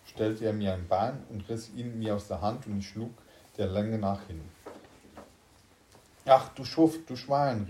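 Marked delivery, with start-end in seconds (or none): 6.2-7.7 louder, higher outburst of insults; indignant cry. A narrator's reading. Narrator cursing his double for tripping him, indignant cry. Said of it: "rief ich".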